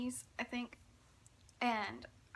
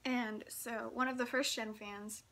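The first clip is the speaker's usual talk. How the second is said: ordinary talk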